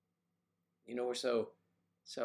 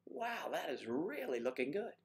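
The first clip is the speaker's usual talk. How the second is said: 1 mock admiring compliment; 5 semitones higher than usual. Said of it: "we would say".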